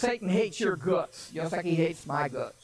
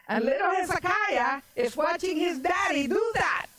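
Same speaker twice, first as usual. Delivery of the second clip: high-pitched, indignant sermon storytelling; impassioned preaching; 9 semitones higher than usual. Acted. Preacher tells of King Ahaz shutting the temple, impassioned preaching. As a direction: preach it high, driving each phrase home.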